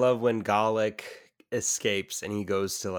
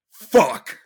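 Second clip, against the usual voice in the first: blurted curse; 5 semitones higher, 11 dB louder than usual. Retold or imitated. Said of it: "everyone's dead silent, and he just goes".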